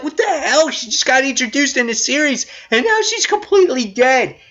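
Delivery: high, incredulous protest; can't believe what just happened; outraged disbelief. Retold or imitated. Reviewer recalling his outrage at the twist, high and incredulous. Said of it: "I'm like".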